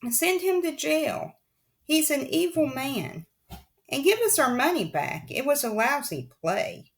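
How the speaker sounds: high jeering calls from the crowd; crowd cry. Acted. Theater crowd jeering at Olaf's arrest. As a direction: heckle like an angry audience. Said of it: "other people took up the cry".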